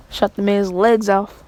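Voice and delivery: plain reading voice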